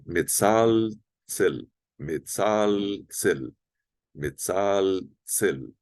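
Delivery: metzaltzel very slowly, every consonant sounded; careful consonants. Shown as a demonstration. Teacher helping Brazilians, slow, carefully articulated metzaltzel. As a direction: draw out metzaltzel with full L and TS. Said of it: "Tem que pronunciar bem as consoantes".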